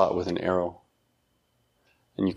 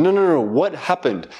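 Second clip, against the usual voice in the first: impatient parent; 7 semitones higher than usual. Retold or imitated. Father pressing crying son for what happened, impatient. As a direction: cut off the crying and demand the story. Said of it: "and I'm saying".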